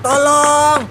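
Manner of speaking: loud, high desperate cry for help; hammed-up shout for help while stuck; calling for help. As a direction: scream for someone to come save you. Crying out for help.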